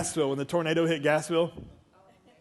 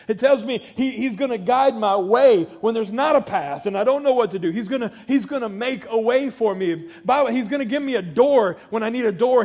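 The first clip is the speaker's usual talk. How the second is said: raised, driving sermon delivery; impassioned preaching; 6 semitones higher, 6 dB louder than usual. Real, not acted. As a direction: preach it loud and urgent, pitched high.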